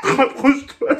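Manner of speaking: laughing. Real, not acted. Laughing through face mask.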